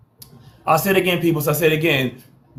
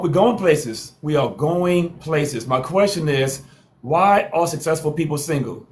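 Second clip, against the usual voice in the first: normal talking voice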